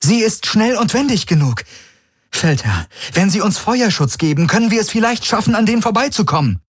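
high, hurried pleading; excited urging. A narrator's reading. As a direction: rush out the plan, voice high and eager. Kirai excitedly urges escape plan. (aufgeregtes, hastiges Drängen)

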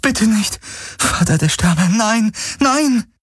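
high, anguished plea; Kirai despairing. A narrator's reading. Kirai pleads to the Father of Stars, high and anguished. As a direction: cry to the heavens for him.

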